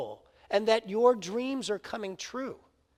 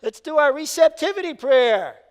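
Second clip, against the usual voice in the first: prayer; 3 semitones higher, 6 dB louder than usual. Acted. Spectator dodges with a prayer.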